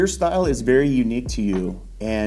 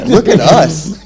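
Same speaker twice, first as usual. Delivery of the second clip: laughing; 8 semitones higher, 10 dB louder, slower than usual. Real, not acted. Host laughs at own outfit.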